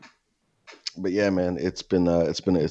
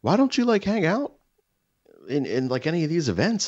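high, pleading neighbor impression; 10 semitones higher than usual. Neighbor pressing him to come to events, high and coaxing. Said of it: "And he goes".

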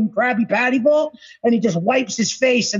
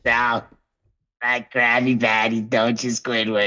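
low teasing voice; 13 semitones lower than usual. Retold or imitated. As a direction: drop way down and tease. Quoting SpongeBob, low voice.

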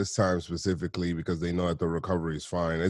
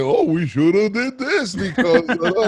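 much higher and louder, laughing through it; mock regret, laughing; 13 semitones higher, 7 dB louder, slower than usual. Imitating fans regretting a skipped surgery, laughing and high. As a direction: parody the hindsight whining. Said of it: "where it's like".

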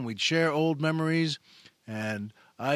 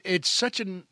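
ordinary talk, nothing marked

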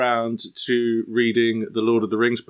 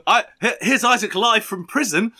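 high, laughing announcer send-up; amused, high-pitched show intro; mock broadcast host; 9 semitones higher than usual. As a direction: announce a spoof live show through laughter. Joke mock broadcast from prison.

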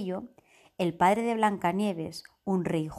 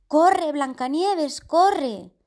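urgent shout; 7 semitones higher, 7 dB louder, much slower than usual. Acted. Hunter urges her to flee.

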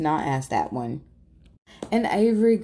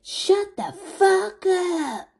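very high, slow, drawn-out retort; mock snapping; 3 dB louder, much slower than usual. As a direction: drag each word out with contempt. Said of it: "And Angela's like".

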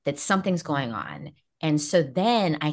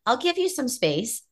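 voicing the busy part; 13 semitones higher than usual. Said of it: "this busy part's like".

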